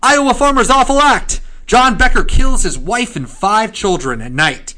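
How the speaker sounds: high, loud headline hawking that settles down; newsboy calling out, easing off; newspaper kid. Pretend. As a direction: hawk the headline then read on. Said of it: "the newspaper voice".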